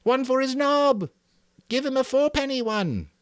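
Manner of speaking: very high, loud shouts; boys shouting. A narrator's reading. The hunters shouting as they mock the pig. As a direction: yell it in a wild frenzy. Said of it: "making pig-dying noises and shouting".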